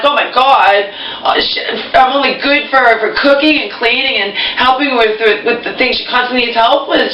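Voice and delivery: high, exasperated complaint; exasperated rant. Retold or imitated. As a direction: vent it, voice climbing high. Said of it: "I'm like".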